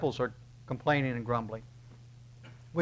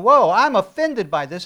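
mock offended; 5 semitones higher, 11 dB louder, slower than usual. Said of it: "And they're going".

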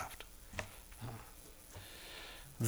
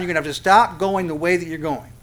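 usual speaking voice

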